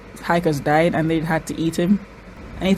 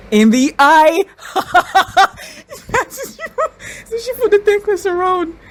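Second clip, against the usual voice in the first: high squeal breaking into laughter; real high, aghast reaction; appalled disbelief; 11 semitones higher, 4 dB louder than usual. Real, not acted. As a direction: squeal it through shocked laughter. Appalled laughing at gruesome scene.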